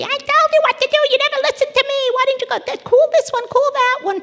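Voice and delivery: high, loud, nagging rant in a mother's voice; mother scolding her daughter in a hammed-up high rant; mock ranting mother. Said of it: "shouting and ranting and raving".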